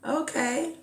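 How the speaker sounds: mild sceptical okay; polite dismissal. Retold or imitated. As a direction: brush him off politely. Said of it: "And I say".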